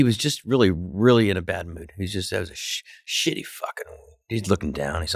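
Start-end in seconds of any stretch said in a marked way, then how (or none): none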